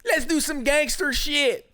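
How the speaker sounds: high-pitched mock tough guy; jeering high imitation of tough talk; mocking the coach. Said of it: "He talking about".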